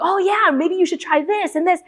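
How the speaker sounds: Steve Wozniak. Retold or imitated. Famous engineer advising a student on a project, lightly voiced. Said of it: "Steve Wozniak would be like".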